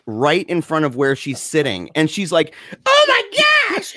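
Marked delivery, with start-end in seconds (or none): 2.9-4.0 high, loud, drawn-out mock scream; freaked-out shriek. Storyteller as the girl seeing the mess, freaked-out shriek. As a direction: shriek it slowly in horrified falsetto. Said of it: "she's totally freaking out".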